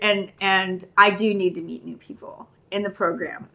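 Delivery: usual speaking voice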